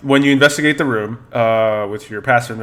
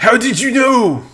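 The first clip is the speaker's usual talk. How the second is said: put-on very high surprised French accent; french chef; 12 semitones higher, 5 dB louder than usual. Candelabra surprised.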